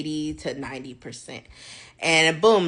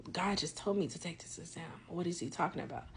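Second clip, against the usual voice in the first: a much softer, murmured thought; quiet aside; 14 dB quieter than usual. Retold or imitated. Talking to herself about the exam, softly murmured. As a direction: murmur it under your breath.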